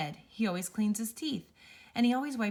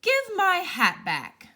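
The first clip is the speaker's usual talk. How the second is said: slow, high, loud furious command; angry demand; 11 semitones higher, 8 dB louder, slower than usual. Acted. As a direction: drag out each word in outrage. Angry man demands his hat.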